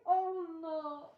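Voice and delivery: high, loud whimpered oh no; mock-tearful dismay. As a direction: wail it as if overwhelmed by attention. Reenacts being overwhelmed by the cheering.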